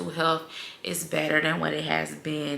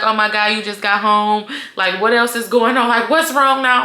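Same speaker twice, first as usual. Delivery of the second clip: louder, alarmed grandma asking what's wrong; worried grandma; 4 semitones higher, 7 dB louder than usual. Retold or imitated. Grandma alarmed on the phone, louder worried voice. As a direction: play the grandma panicking on the phone.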